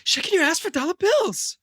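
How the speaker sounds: high put-on actor voice; quoted film character. Acting out a scene for a friend, high put-on voice. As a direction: pitch it up like an indignant movie star. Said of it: "When he's like".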